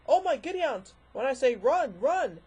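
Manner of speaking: loud, high eccentric shout; excited Doctor voice. Pretend. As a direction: yell it like a mad Time Lord. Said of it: "this is Hoovy and Queen saying".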